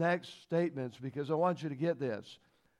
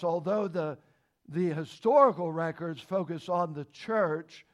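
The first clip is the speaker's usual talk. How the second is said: preaching at full force; 3 semitones higher, 5 dB louder than usual. Acted. Pastor pressing the point on Israel to the congregation, forceful. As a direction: drive the point home to the congregation.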